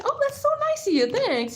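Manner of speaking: high, mocking sugary voice; mocking sweet reply. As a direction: imitate a fake-polite guy. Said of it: "this guy said".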